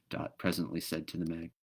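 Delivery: ordinary delivery, nothing marked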